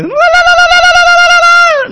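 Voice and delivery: very high, loud trilled lalala; imitating ululation. Preacher imitating a Kenyan sister's ululation at the conference, high and loud. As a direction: mimic a woman's endless trill. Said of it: "就一直听到有一个女生".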